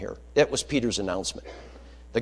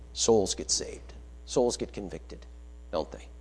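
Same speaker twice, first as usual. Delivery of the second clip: hushed emphasis; 4 semitones lower, 7 dB quieter than usual. Real, not acted. Preacher drops to quiet emphasis.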